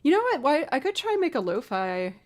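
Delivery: excited. Real, not acted. Sudden idea, excited exclamation.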